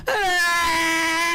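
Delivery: long, high held scream; test scream. Pretend. Gamer testing whether the player can hear, test scream. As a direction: hold one high scream.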